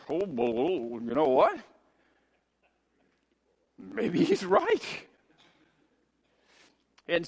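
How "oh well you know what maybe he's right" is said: slow, halting second thoughts; hesitant reconsidering. Acted. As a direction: waver your way toward agreeing.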